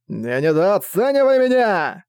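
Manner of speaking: high, slow, stretched-out yell; defiant shout. Acted. Filit to his brother as he attacks, defiant shout. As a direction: hurl it across the water, drawn out.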